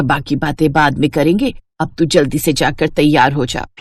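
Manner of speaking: a mother hurrying her daughter along; mother's voice. Acted. Mother urging daughter to dress.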